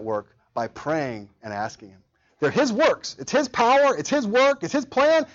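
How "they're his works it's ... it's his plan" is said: climbing higher and louder line by line; preaching build. Acted. Preacher stirring his congregation, rising to full force. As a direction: build the list toward a peak.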